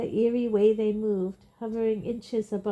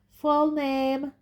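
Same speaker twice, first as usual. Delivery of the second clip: a louder, higher demand; 6 semitones higher, 5 dB louder than usual. A narrator's reading. Fudge demanding the witness's name, loud. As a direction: call it out across the court. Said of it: "said Fudge loudly".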